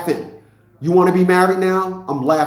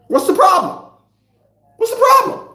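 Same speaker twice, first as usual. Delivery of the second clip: much higher, mock-whiny woman voice; mock woman repeating herself, rising higher each time; mocking woman's whine. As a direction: parrot her complaint in a high put-on whine.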